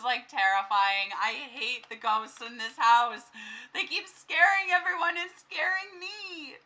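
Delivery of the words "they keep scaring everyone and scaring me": higher, half-crying whine; whiny complaint. Real, not acted. Gamer complaining about the ghosts, whiny voice. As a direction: whine like you might cry.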